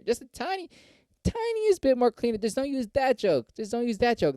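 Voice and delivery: high, mock-finicky nagging; pleading club booker. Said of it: "That's all they're gonna say".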